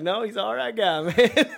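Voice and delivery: high, loud mock retreat, breaking into a laugh; the dazed guy giving in while the teller laughs; laughing backpedal. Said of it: "He's like".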